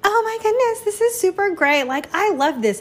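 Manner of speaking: high, overexcited gushing; high-pitched gym enthusiast, mock gushing. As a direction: ham up fake delight. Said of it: "don't be super like".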